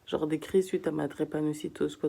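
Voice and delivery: usual speaking voice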